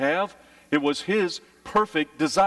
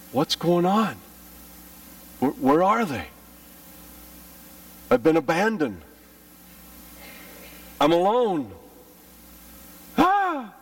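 put-on scared child; 5 semitones higher than usual.

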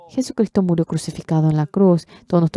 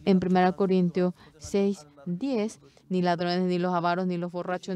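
scripture reading; 5 dB quieter, faster than usual. Acted.

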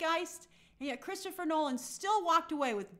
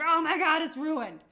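mock spoiler panic; 3 semitones higher, 6 dB louder than usual. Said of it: "you're like".

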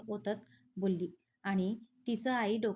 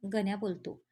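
ordinary delivery

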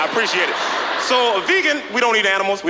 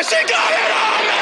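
mock panic. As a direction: yelp in comic horror at the makeup. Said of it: "I was like".

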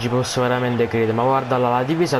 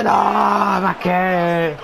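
dismayed shout; 4 semitones higher, much slower than usual. Real, not acted. Occasione sbagliata.

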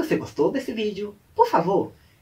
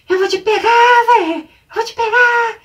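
high, full-voiced mock scream; chasing threat yell; 11 dB louder than usual. Retold small boy chasing the older one, hammed-up high yell. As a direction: yell it as a kid in hot pursuit.

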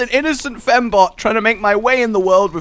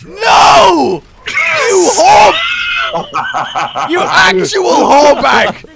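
high, loud shrieked insults; outraged screaming; 10 semitones higher, 5 dB louder, much slower than usual. Real, not acted. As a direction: scream abuse at the player who got you. Insulting opponent after hook.

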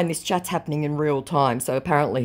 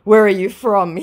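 slow, higher, louder drawn-out question; mock scammer voice; 6 semitones higher, 7 dB louder, slower than usual. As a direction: scammer repeating himself, mocked. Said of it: "he says".

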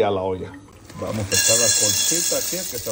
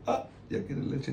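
hushed; 11 dB quieter, slower than usual. Real, not acted. Mother murmurs to her hungry baby, hushed and slow.

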